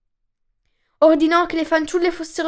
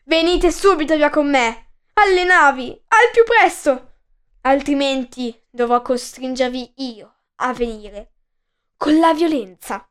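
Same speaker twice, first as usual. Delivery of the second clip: shouting threat. Acted. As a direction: bark orders at the frightened girls. Warrior threatens the girls.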